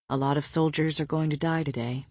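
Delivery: low, soft and grave; said quietly. A narrator's reading. Man confiding to his mate before battle, quietly. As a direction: drop low and hushed. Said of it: "he said quietly".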